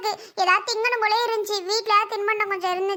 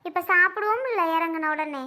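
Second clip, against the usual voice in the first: normal talking voice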